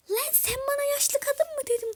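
high, loud, affronted screech; outraged grandmother. Acted. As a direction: shriek in offence at being called old. Grandmother outraged at being called old.

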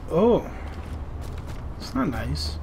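normal talking voice

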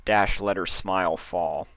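usual speaking voice

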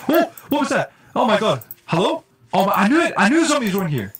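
high, loud startled outburst; startled alarm. Real, not acted. Player spotting zombies in here, loud and alarmed. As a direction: jump and cry out in alarm.

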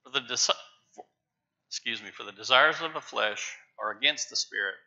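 usual speaking voice

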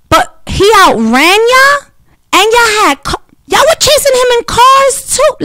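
can't believe the cops got outrun; disbelief. Real, not acted. To viewers, mocking cops who lost the chase, squeaky incredulous.

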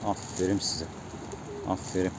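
quiet, tender praise for the birds; soft praise. Real, not acted. Vlogger softly praising his feeding pigeons, tender. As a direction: murmur approval to the pigeons.

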